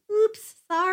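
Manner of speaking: high, mock-apologetic oops; Rogue quote. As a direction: toss off a cutesy fake apology. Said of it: "And Rogue's like".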